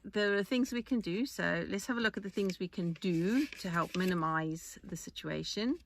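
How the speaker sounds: normal talking voice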